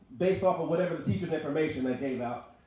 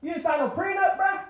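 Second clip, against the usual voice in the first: mock shock; 11 semitones higher, 4 dB louder than usual. Comedian teasing crying kid.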